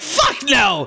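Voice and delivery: high, emphatic outburst; appalled bandit's mock yell; yelled refusal. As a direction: refuse flat out, pitched high.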